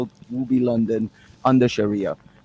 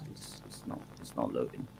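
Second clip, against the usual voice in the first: muttered aside; 20 dB quieter than usual. Real, not acted. Muttering as the stream stalls.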